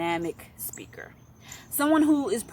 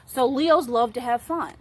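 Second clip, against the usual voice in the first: ordinary talk, nothing marked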